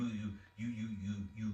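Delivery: ordinary talk, nothing marked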